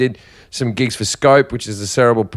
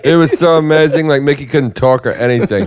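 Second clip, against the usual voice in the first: delighted imitation breaking into laughter; Tom Stade impression; 2 semitones higher, slower than usual. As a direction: mimic a thrilled comic, stretching the last words. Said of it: "He goes".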